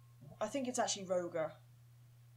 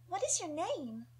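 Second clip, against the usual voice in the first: put-on high, playful young girl's question; curious little girl; 10 semitones higher than usual. Young Nita curiously asking a stranger's name, cute. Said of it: "sound curious, playful and cute".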